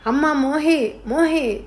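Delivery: high, desperate yelling of her name; father yelling. A narrator's reading. Father kicks kitchen door, screaming for daughter, high yell. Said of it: "అరుస్తున్నారు".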